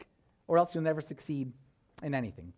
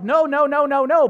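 louder, higher string of protests; retold frantic refusal, raised voice; urgent refusal; 7 semitones higher, 8 dB louder than usual. Preacher resisting being pushed onto the stage, protesting.

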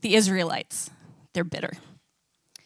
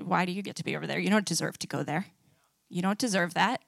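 bitter resentment; 5 semitones lower, 8 dB quieter, faster than usual. Acted. Bitter Israelites resenting Moses.

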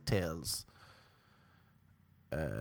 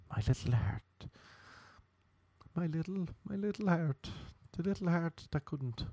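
small, higher, softer poor-me voice; mock heartbroken; 6 semitones higher, 5 dB quieter than usual. Comedian pitying his own broken heart, mock heartbroken. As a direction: play up the wounded little heart. Mock self-pity over breakup.

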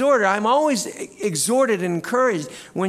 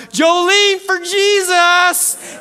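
very high, loud, stretched yell; imitated yell; 13 semitones higher, 9 dB louder, much slower than usual. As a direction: holler it from the field like a fan. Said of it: "he'll even call it out".

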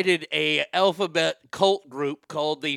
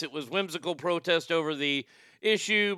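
ordinary delivery

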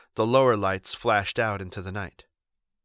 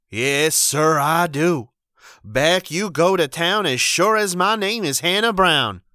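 high, loud unbending wife; firm stern refusal; 8 semitones higher, 8 dB louder than usual. A narrator's reading. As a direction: hold the line, no mercy.

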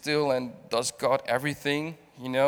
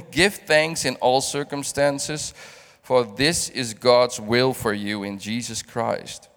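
scripture reading; 2 semitones lower, 3 dB louder than usual. Acted. Reads scripture aloud.

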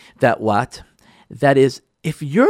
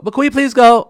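higher, louder pleading ask; pleading spouse; 8 semitones higher, 5 dB louder than usual. Acted. Wife begging her husband to visit her sister, pleading higher. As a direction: beg sweetly to go to the sister's.

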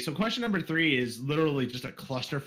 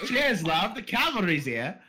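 bad British accent; 3 semitones higher, slower than usual. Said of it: "that was the weirdest accent".

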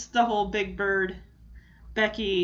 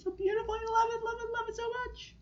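gushing fondly; 12 semitones higher, 6 dB quieter, faster than usual. Real, not acted. Gushing about the clip.

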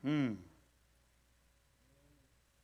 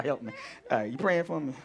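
ordinary talk, nothing marked

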